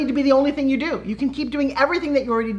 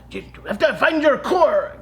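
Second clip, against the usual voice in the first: higher, slow mock Russian bellow; yelling Russian teacher; 4 semitones higher, 4 dB louder than usual. Russian dance teacher ordering her pupil, yelled Russian accent. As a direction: imitate a Russian teacher yelling, words drawn out. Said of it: "a yelling kind of Russian teacher".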